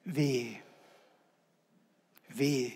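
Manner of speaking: soft, falling "Wehe"; quiet grave warning; solemn warning. Acted. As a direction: let each "Wehe" drop like a verdict.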